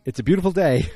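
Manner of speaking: loud, high, jubilant proclamation; cheerful announcement. Retold or imitated. Roger popping into Don's room, exuberant mock cheer.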